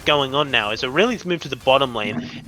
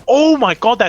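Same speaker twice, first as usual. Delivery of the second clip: excited; 9 semitones higher, 8 dB louder than usual. Real, not acted. Caster reacting to big play.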